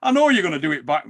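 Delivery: ordinary talk, nothing marked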